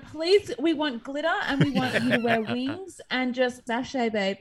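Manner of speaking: high, loud, hammed-up pleading; mock camp plea. Joking about a Pride jersey demand, mock camp plea. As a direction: plead in an exaggerated camp put-on voice.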